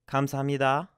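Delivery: "감사합니다" with the final "니다" sounding like "디다"; korean thank you. Shown as a demonstration. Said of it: "It sounds more like a D".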